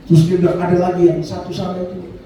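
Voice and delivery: ordinary delivery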